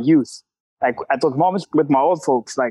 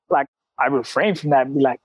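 ordinary talk, nothing marked

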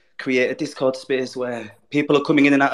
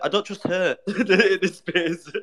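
laughing; 5 semitones higher than usual. Real, not acted. Joking about untrustworthy mum.

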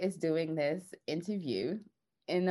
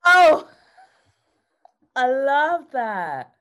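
delighted; 9 semitones higher, 12 dB louder, much slower than usual. Real, not acted. Host thrilled by guru spelling.

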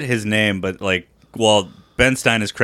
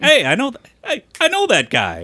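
much higher, excited recognition; goofy high voice. Retold or imitated. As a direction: squeal it out in a high voice. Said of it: "But it's just like".

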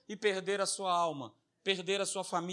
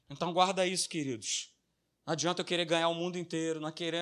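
ordinary delivery

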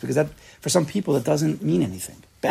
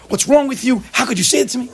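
higher, hammed-up indignant scolding; mock angry parent; 11 semitones higher, 3 dB louder than usual. Parent scolding a three-year-old, mock angry and higher. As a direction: play the offended parent scolding a toddler. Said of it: "a parent gets all angry".

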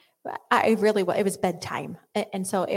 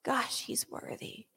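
awed hush; 5 semitones higher, slower than usual. Pretend. Believer imagining worship, whispering in awe. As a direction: breathe it out in awe.

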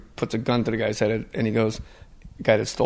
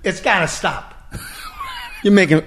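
Walken upset; 9 semitones higher, slower than usual. Retold or imitated. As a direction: squawk it out, put out by the teasing. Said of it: "and he goes".

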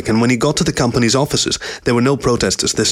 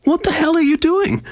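high-pitched indignant shock. A narrator's reading. Cyan to Richard as he puts on his coat, shocked. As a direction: demand it in shock, voice shot right up. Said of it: "Cyan asked with shock".

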